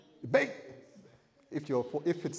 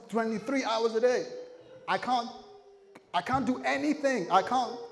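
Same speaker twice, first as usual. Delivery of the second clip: higher, louder mock-earnest plea; pleading prisoner; 8 semitones higher, 4 dB louder than usual. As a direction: beg to serve the sentence anyway. Imagined prisoner pleading with Jesus.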